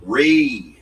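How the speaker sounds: Re punched high and loud; stress on RE. Shown as a demonstration. Showing how he stressed the first syllable, a loud punched Re. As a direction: hit the syllable Re hard and loud. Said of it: "He put the emphasis on re".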